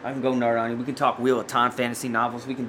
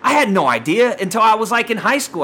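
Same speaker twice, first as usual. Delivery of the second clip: higher, worked-up disbelief; heated rant; 6 semitones higher than usual. Real, not acted. Podcaster ranting about celebrities' cartoon apologies, heated. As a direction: fire off indignant questions in a raised pitch. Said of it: "I'm gonna have to simmer down now".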